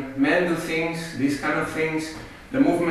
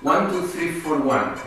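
brisk, rhythmic dance count; quick, even counting for dancers; double-time count; 2 semitones higher than usual. Shown as a demonstration. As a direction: count the beats twice as fast. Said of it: "we will do it twice as fast".

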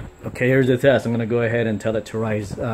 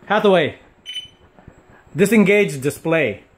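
voice command; 5 semitones higher, 4 dB louder than usual. Real, not acted. Commanding the suit's voice system.

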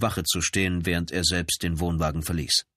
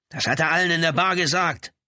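high, forceful answer; heated retort; 11 semitones higher, 5 dB louder than usual. A narrator's reading. Brother answers the lieutenant's question, heatedly. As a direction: throw the answer back hotly.

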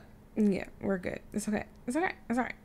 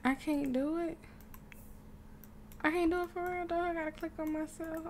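higher, mildly whiny helplessness; near-tears whine; 6 semitones higher, 5 dB quieter than usual. Real, not acted. Player unable to do it, mock near tears. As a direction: whine as if about to cry. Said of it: "I'm about to C R Y".

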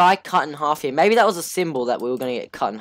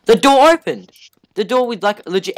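startled surprise; 9 semitones higher, 8 dB louder than usual. Real, not acted. Door suddenly opens.

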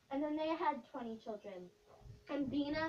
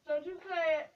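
louder mock threat, don't you dare; a teasing, louder don't you say it; playful warning; 4 semitones higher, 9 dB louder than usual. Real, not acted. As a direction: warn her off, half-joking. Warning friend not to say name.

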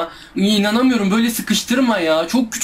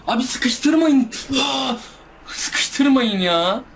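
slow, high, stretched whine; slow pleading whine; drawn-out plea; 6 semitones higher, much slower than usual. Real, not acted. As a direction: beg them to stop crowding you. Pleading while cornered in game.

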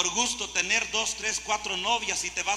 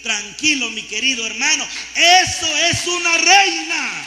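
preaching shout; 8 semitones higher, 10 dB louder than usual. Real, not acted. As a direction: proclaim it at full force. Preacher exclaims at the congregation.

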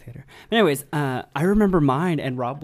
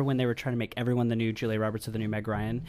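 normal talking voice

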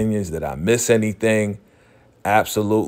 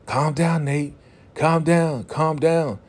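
higher-pitched mock plea to calm down; retold urgent pleading; 7 semitones higher, slower than usual. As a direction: imitate someone trying to settle a fight, pitched up. Acting Issa calming Nate down.

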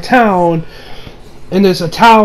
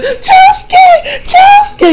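much higher, crying out his name; wailing girl; 3 dB louder, much slower than usual. Retold or imitated. Girl crying over the beaten Josuke, high wail. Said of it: "the girl's like".

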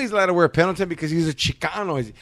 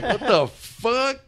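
baffled; 9 semitones higher, much slower than usual. Retold or imitated. Retold baffled reaction.

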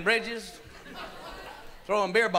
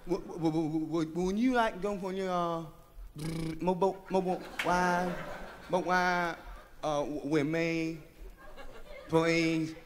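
softer, lower nervous stutter; bashful stammer; 5 semitones lower, 6 dB quieter, slower than usual. Acted. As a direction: stammer it out, too shy to ask. Bashful man stammering an invitation.

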